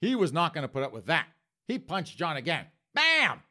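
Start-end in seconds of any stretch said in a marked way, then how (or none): 2.9-3.4 loud high "bam"; cartoon punch shout; punch sound.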